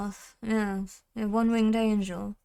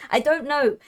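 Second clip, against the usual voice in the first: ordinary talk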